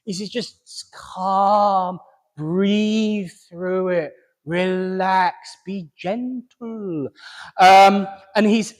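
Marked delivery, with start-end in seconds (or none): 0.3-7.1 soothing gentle voice. Retold or imitated. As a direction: ease someone down gently, very slowly. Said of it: "emphasize what he's saying with the tone".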